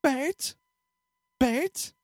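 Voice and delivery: put-on a little kid asking "Bert?" with a rising pitch; mock kid mispronouncing. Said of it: "he looks at me and goes".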